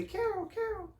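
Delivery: high, soft sing-out call; imitated distant call, pitched high. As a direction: call a name as if from across the park. Someone calls her across park.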